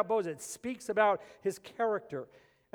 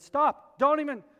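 loud, higher scolding outburst; going off on her; 5 semitones higher, 3 dB louder than usual. Retold or imitated. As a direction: tell her off, loud and hard. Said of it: "I just kinda went off on her".

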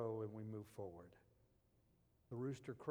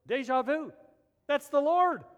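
loud, high excited cry; John realizing it's the Lord, exclaiming loudly; excited recognition; 12 semitones higher, 17 dB louder than usual. Retold or imitated. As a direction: burst out as it dawns on you. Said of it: "And immediately John goes".

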